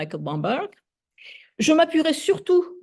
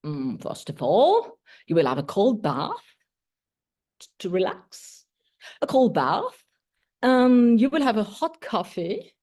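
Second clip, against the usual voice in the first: dole out the plan like a playful beauty guru; understated teen girl giving mock instructions; reading Vivi's part.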